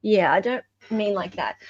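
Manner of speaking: ordinary talk, nothing marked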